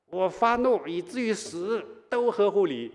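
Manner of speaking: high-pitched, mildly hysterical retort; near-hysterical. Acted. Jonah rejecting God's goodwill, near-hysterical. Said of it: "反而以近乎歇斯底里的口气说".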